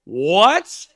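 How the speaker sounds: high, loud rising disbelief; stunned outburst at the news; shocked. Retold or imitated. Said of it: "I was like".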